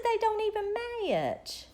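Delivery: high-pitched retold motherly objection; mom's protest. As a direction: point it out to the child, voice pitched high. Retold words to little girl.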